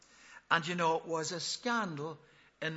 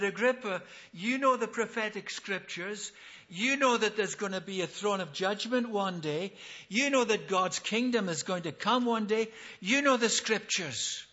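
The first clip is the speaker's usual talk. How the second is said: loud, insistent, raised-pitch appeal; impassioned preaching; 4 semitones higher, 5 dB louder than usual. Acted. Paul challenges Agrippa on the prophetic scriptures, impassioned preaching. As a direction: drive each point home to the king.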